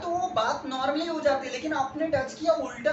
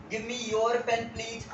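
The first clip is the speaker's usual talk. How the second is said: "Give me your pen please" drawn out slowly, each word clear; slow teaching read; much slower than usual. Shown as a demonstration. Teacher modelling a polite request slowly. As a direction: dictate it slowly. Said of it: "simple सा".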